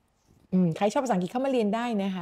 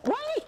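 mock shriek. Teacher acts a startled shriek.

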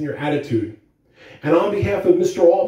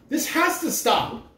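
mild declaration; 9 semitones higher, 5 dB quieter than usual. A narrator's reading. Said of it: "I declared".

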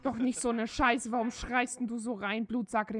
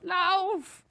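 shout; 8 semitones higher, 8 dB louder than usual. Real, not acted. Fleeing mobs, yelling to run.

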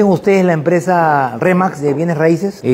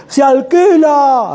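slow, high, loud "Se alquila" drawn out in discovery; high, slow exclaimed "Se alquila"; excited reading; 9 semitones higher, 4 dB louder, much slower than usual. Retold or imitated. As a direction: stretch "alquila" out loudly, excited by the sign. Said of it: "Así, así, no, decía".

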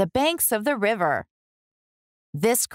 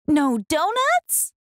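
high, slow disbelieving no donuts; dismayed question; 7 semitones higher, slower than usual. Acted. Hungry townsperson finding no donuts, dismayed. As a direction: sound let down that there are none.

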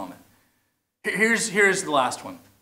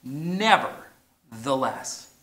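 drawn-out "Nevertheless" in unison; slow unison; much slower than usual. Shown as a demonstration. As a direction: lead the crowd slowly through "Nevertheless". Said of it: "say that with me".

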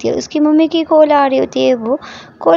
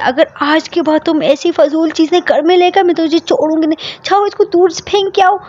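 angry yell; 7 semitones higher than usual. A narrator's reading. Mother yelling at the worker over the puppy, angry. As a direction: tear into the worker, rising higher. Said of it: "वो उसके ऊपर चिल्लाती है".

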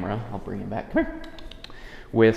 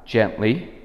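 slow soothing gently; much slower than usual. Shown as a demonstration. Trainer soothing the dog while offering a chew, slow and calm. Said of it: "I can present it slowly".

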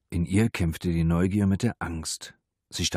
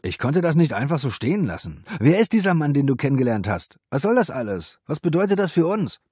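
loud, high offended questioning; heated, hurt outburst; aggrieved confrontation; 8 semitones higher, 7 dB louder than usual. A narrator's reading. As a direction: fire accusing questions at your girlfriend. Jealous boyfriend confronts her, defending himself.

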